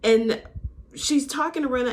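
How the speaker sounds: usual speaking voice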